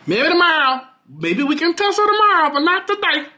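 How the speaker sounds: high, playful "maybe tomorrow"; teasing. Real, not acted. Vlogger teases New Orleans commenters in a high sassy voice.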